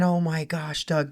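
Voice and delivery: ordinary delivery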